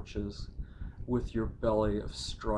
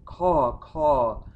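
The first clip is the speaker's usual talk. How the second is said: loud, higher crow caws, falling; crow cries; 4 semitones higher, 7 dB louder than usual. Pretend. Poet crying like crows over the grave, loud high caws. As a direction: caw out like a crow overhead.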